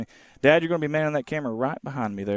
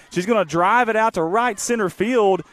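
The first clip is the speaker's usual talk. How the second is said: much higher, louder rush of excitement; excited play call; 8 semitones higher, 5 dB louder than usual. Real, not acted. Announcer calling a hit for stream viewers, excited and loud. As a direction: call the hit like it's a big moment.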